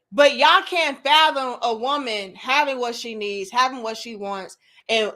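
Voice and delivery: ordinary talk, nothing marked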